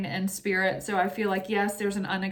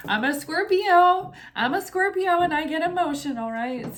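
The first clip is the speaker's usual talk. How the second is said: higher, louder, voice on the edge of tears; fighting back real tears with a laugh; getting emotional; 9 semitones higher, 5 dB louder than usual. Real, not acted. Thanking donors and stopping herself before crying, higher emotional voice. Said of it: "I'm gonna get cry".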